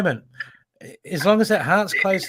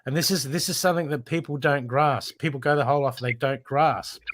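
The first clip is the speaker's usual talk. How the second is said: ordinary talk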